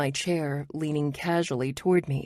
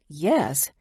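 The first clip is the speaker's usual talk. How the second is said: sassy; 3 dB louder than usual. A narrator's reading. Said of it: "I sass".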